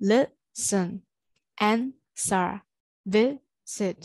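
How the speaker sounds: "listen", "answer", "visit" broken into two separate syllables; two-beat teaching demo of "listen", "answer", "visit"; split into syllables. Shown as a demonstration. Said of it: "two syllable ဆိုတာမျိုးဆိုပါလဲ".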